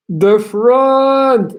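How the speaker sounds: the name called out high and loud; excited greeting. Real, not acted. Streamer calling out a viewer's name, excited.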